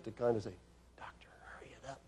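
hushed, breathy aside to the doctor; urgent whisper. Retold or imitated. Nurse whispering to the doctor to hurry up. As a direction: whisper it so the patient can't hear.

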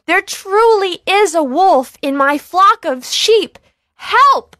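high, loud frightened plea; frightened cry. Acted. Boy begging the villagers to believe him, loud and high. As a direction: call for help in a great fright. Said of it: "In a great fright".

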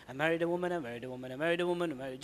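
low, monotone mantra; self-reminder recited in an even murmur; tasbih-style repetition. Acted. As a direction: drone it like counting prayer beads. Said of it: "keep a tasbih in your hand and say".

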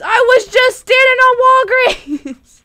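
high, loud exasperated wail; exasperated cry. Real, not acted. Player keeps losing Walgreens in the game, high exasperated shout. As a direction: shout it in playful disbelief.

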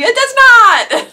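loud, high, drawn-out denial; mock-indignant protest. Real, not acted. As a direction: protest it loudly, stretching it out. Playful protest to the card.

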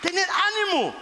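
high, emphatic call of encouragement; lightly acted commanding reassurance; Jesus calling out. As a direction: hearten the frightened disciples. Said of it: "Jesús le dijo diciendo".

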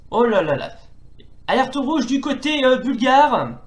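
lively football play-by-play; french sports commentary. Acted. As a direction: call the match live, rising with each attack. (commentaire sportif à la télé)

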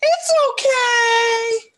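very high, loud, drawn-out mock crying; tearful wail. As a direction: stretch it into an overwrought sobbing wail. Acting out story characters as they hug.

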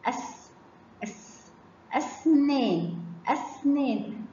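أس cut off on a hiss, then أسنان slowly; sounding out seen. Shown as a demonstration. Teacher lets pupils hear seen, أس hissed before a slow أسنان. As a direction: break off أس on its s before giving أسنان. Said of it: "تعالوا نسمع صوت السين".

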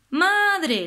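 "Madre" subido y marcado en la sílaba "Ma"; énfasis en la rima. Shown as a demonstration. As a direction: marca fuerte "Madre" para señalar la tónica. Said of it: "pues adre". (profesora resalta "Madre" para la rima)

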